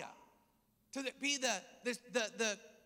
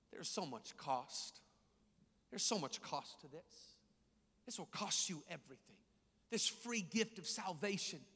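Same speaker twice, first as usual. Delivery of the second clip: much softer, lower confiding sermon; hushed preaching; 6 semitones lower, 6 dB quieter than usual. Acted. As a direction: drop to a soft, intimate preaching voice. Quiet confiding stretch of sermon.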